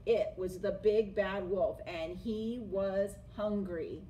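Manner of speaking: usual speaking voice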